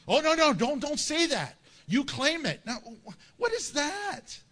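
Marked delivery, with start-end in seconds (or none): 0.1-2.6 higher, louder scolding imitation; fussy, alarmed believer, parodied; mock alarm. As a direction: hurriedly hush someone, hammed up. Mimics an alarmed faith-healing believer.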